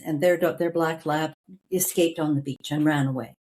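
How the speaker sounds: ordinary talk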